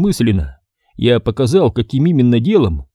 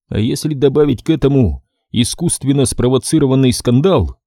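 plain reading voice

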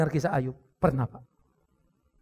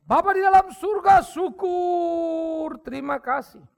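loud, higher cry to God; fervent prayer; 13 semitones higher, 9 dB louder, much slower than usual. Acted. Pastor leading the congregation in prayer, fervent loud praise. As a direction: call out in praise.

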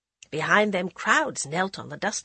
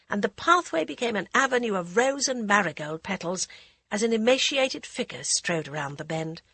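plain reading voice

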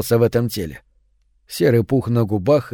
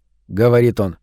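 plain reading voice

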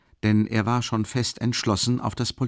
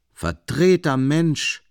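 high, louder, slow verdict; dismissive worker; 7 semitones higher, 5 dB louder, slower than usual. A narrator's reading. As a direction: drag out the scorn. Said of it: "sagte der Arbeiter".

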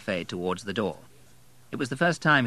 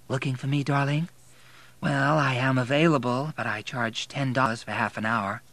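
higher-voiced working girl; 5 semitones higher than usual. A narrator's reading. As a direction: proposition him as the bar girl.

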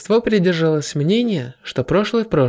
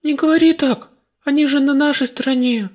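put-on girl protesting; 10 semitones higher than usual. A narrator's reading. Goddess protests to hero.

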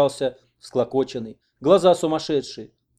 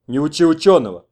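loud, testy retort; snapping back; 3 semitones higher, 9 dB louder than usual. A narrator's reading. Kurov answers a man telling him how to carry, snapping back. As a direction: snap at the meddler. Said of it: "огрызнулся Куров".

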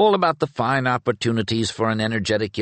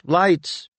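second call pitched higher and pressing; urgent call; 10 semitones higher, 3 dB louder than usual. A narrator's reading. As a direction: call again, climbing up in urgency. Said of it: "Penrick's voice out of the shadows called".

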